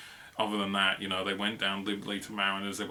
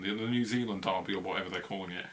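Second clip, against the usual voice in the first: ordinary talk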